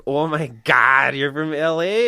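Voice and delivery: drawn-out Minnesota "oh my God"; Minnesota accent. Retold or imitated. Attractive woman amazed by an LA visitor, Minnesota accent. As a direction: gush it in a hammed-up Minnesota accent. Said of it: "Girls have ridiculous accents".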